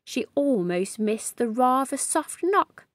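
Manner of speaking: ordinary delivery, nothing marked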